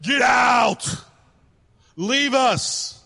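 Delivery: high, forceful shouted command; screaming Pharaoh. Acted. Preacher acting Pharaoh ordering Moses out, screaming. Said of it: "Pharaoh screaming at him".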